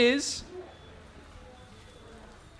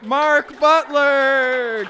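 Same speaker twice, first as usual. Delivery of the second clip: drawn-out announcement; 3 semitones higher, 4 dB louder, much slower than usual. Acted. Host corrects the winner's name to the crowd, drawn out announcer style. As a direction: stretch the name out slowly like an announcer.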